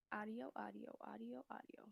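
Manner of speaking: soft, absent-minded repetition; muttering to self. Real, not acted. Streamer hunting for audio on the computer, muttering. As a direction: murmur it under your breath while searching.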